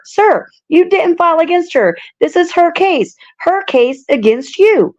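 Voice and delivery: acted exasperation. Judge telling the defendant whose case it is, mock exasperated. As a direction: spell it out, slowing on the last phrase.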